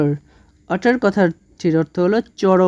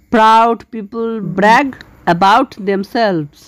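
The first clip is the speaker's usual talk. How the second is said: slow example sentence; much slower than usual. Shown as a demonstration. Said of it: "দেখো, এই ওয়ার্ডটি আমরা একবারে use করতে পারি".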